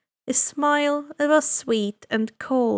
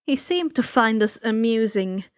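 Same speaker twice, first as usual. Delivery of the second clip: usual speaking voice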